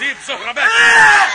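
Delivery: loud, slow exorcist command; commanding shout. Acted. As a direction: roar the command at the demon. Saint commands the demon. (أمر طرد بصوت عالي)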